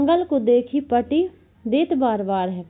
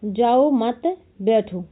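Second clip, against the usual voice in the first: "जाओ मत", long pause, then "बैठो"; slowly group "जाओ मत" together, set "बैठो" apart; pause after मत; much slower than usual. Shown as a demonstration. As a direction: break the phrase after "मत". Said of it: "उसने कहा".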